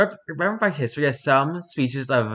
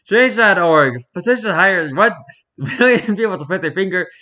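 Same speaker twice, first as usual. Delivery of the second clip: louder, higher mock-earnest pitch with a laugh inside; mock petition pitch; 4 semitones higher, 4 dB louder than usual. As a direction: sell the silly petition with a straight face. Reads absurd petition aloud.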